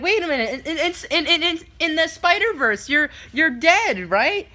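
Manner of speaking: put-on high stammering child voice; puzzled little kid. Kid questioning Spider-Man's story, imitated confused child. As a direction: stumble over it like a kid working it out. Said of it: "the third kid to my left was like".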